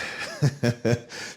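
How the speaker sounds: breathy chuckle; real, voiceless little laugh; soft laugh. Real, not acted. Remembering his late aunt fondly, a breathy chuckle.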